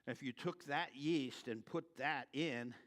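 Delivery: ordinary talk, nothing marked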